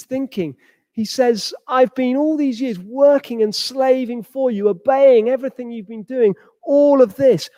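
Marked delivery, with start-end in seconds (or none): 1.7-7.5 resentful. Acted. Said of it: "so vexed, so angry".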